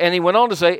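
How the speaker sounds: ordinary talk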